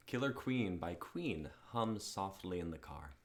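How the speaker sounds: ordinary delivery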